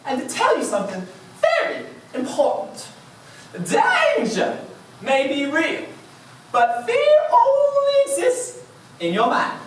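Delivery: high, hammed-up talking bear voice; Hagatha. Talking bear lectures the runner about fear, hammed-up high voice. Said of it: "Hagatha said to me".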